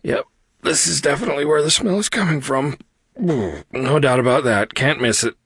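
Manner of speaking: high, put-on voice against a stench; disgusted character voice. A narrator's reading. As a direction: talk through an awful smell. Aria reacting to stink.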